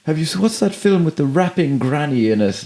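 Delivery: a mother asking about a film, lightly voiced; imitating his mom. Son imitating his mom asking about the film, lightly voiced. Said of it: "she was like".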